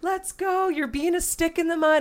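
high-pitched, pushy nagging; impatient, high-pitched retold urging; goading a friend. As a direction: egg them on in a high voice. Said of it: "coming at it in a way of like".

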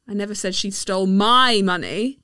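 "my" stressed, rest plain. Shown as a demonstration. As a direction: put the weight on "my". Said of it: "depending on the stressed word".

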